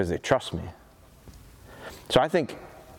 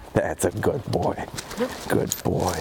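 low calm praise; 6 semitones lower, slower than usual. Real, not acted. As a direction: settle the dog with a low, slow voice.

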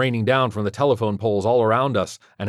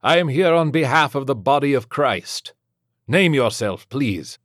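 raised, formal priest's demand; steadfast padre; 5 semitones higher, 4 dB louder than usual. Acted. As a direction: address the demon firmly. Padre formally challenges the demon.

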